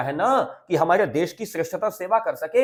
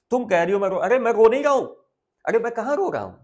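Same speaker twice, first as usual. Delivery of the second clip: father protesting he isn't crying; 5 semitones higher, much faster than usual. Retold or imitated. Teacher voicing the father to mother, insisting he isn't crying.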